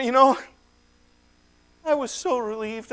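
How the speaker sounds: ordinary delivery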